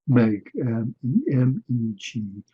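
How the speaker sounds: letter by letter, slow and clear; dictating a name to look up; spelled out letter by letter. Shown as a demonstration. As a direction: spell O N A N I slowly, one letter at a time. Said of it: "Go look her up".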